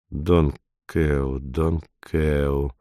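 low, soft mumbling to himself; muttering. A narrator's reading. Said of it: "бормотал он".

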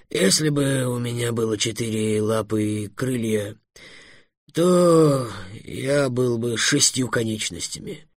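slow, drawn-out dragon voice; patient dragon, mildly offended. Acted. Dragon arguing he is no insect, slow and mildly offended. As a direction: reason it out slowly, stretching the words.

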